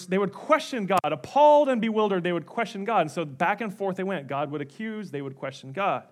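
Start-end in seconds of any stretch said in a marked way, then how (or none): none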